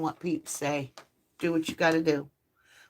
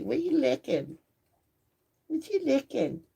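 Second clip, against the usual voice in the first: higher doting voice to the dog; imitating a friend's baby talk; 7 semitones higher than usual. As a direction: sing it like she coos at him. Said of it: "She says".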